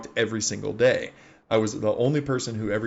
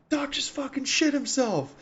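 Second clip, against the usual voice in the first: high, drawn-out mock holler; imitated yell; slower than usual. Gunner yells the news to the other gunner, high mock holler. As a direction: slowly holler the news, pitched way up. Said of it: "he yells to the other gunner".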